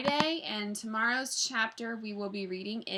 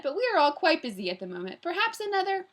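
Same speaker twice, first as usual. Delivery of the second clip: quick, higher Scottish politeness; lightly voiced, flustered Scottish gentleman; pleasant Scottish gentleman; 8 semitones higher, 6 dB louder, faster than usual. A narrator's reading. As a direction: hurry the excuse with a Scottish touch. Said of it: "pleasant voice he took from the Scottish gentleman".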